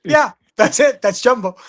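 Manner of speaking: high, loud excited "Yeah, that's it"; a loud, high blurt at the sight of a shirtless Jumbo; excited exclaim. Retold or imitated. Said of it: "I looked at him and went".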